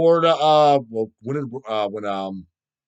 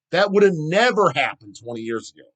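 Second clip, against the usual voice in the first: ordinary delivery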